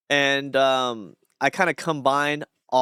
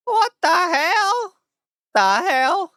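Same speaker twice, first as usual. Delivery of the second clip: high, loud, drawn-out "what the hell"; cracked-voice catchphrase; 6 dB louder, much slower than usual. Shown as a demonstration. Streamer teaching viewers his catchphrase, high cracked character voice. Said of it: "I can teach you how to say it".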